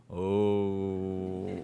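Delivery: long, low drawn-out "oooh"; crowd's taunting ooh. Acted. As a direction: imitate a whole line going oooh. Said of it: "everybody in line say".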